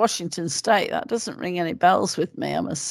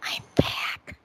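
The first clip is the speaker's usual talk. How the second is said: soft unvoiced whisper; 8 dB quieter than usual. Real, not acted. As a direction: whisper it so you don't interrupt. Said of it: "why are you whispering?".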